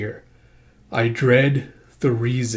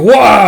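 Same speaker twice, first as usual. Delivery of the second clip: loud, high "wah" of attack; drawn-out battle yell; startled yell; 9 semitones higher, 13 dB louder than usual. Acted. Storyteller voicing the razor hound's sudden lunge, loud yell.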